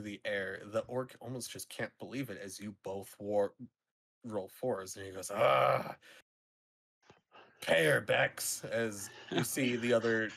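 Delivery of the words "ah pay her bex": loud, slow orc groan; annoyed orc conceding the bet; grudging orc. Acted. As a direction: bark the order like a sore loser. Orc grudgingly tells partner pay.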